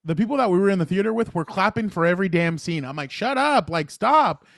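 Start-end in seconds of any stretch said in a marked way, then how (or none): 3.1-4.4 higher-pitched mock exasperation; retold frustration. Moviegoer to clapping audience, retold frustration. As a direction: scold them, imitating your own annoyance. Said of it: "the thing that frustrated me".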